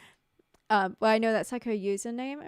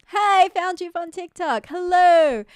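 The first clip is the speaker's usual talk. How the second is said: high, loud, drawn-out hail; calling out a greeting; 9 semitones higher, 12 dB louder, slower than usual. Real, not acted. Streamer greeting a viewer from TikTok, calling out high and loud. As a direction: sing out a big slow hello.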